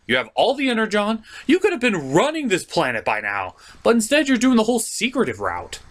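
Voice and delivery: ordinary talk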